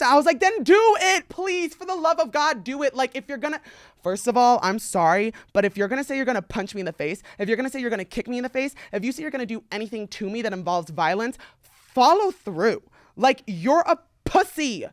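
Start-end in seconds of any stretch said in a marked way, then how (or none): 0.4-3.0 high-pitched, pleading dare; exasperated begging. Retold or imitated. Daring the guy to finally fight, exasperated pleading. 11.6-14.8 slow, drawn-out demand; emphatic scorn. Real, not acted. Telling empty threateners off, slow emphatic scorn.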